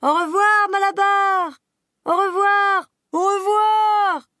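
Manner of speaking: long, high, loud farewell calls, drawn out slowly; calling out. Acted. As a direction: shout goodbye across the yard. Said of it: "criant en chœur". (les animaux crient au revoir à Malabar)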